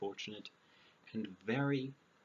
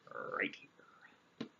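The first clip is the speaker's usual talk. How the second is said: whispered aside; 5 dB quieter than usual. Real, not acted. Aside after naming sexism.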